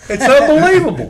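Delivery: high, loud disbelief; amazed. Real, not acted. Host hears the pizza-guy wedding story, loud amazed disbelief. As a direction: blurt it out, floored by the story.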